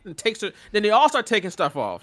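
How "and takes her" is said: usual speaking voice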